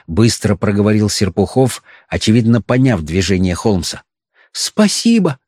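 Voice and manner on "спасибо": put-on old woman whispering. A narrator's reading. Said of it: "прошептала старуха".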